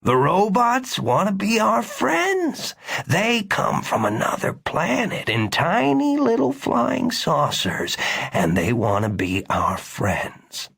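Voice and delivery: slow, patronizing reassurance; humoring doctor. A narrator's reading. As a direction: humor a man you think is confused.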